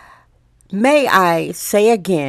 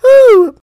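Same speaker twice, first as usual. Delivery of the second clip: high, loud excited whoo; 11 dB louder than usual. Real, not acted. Loud exclamation after the bit.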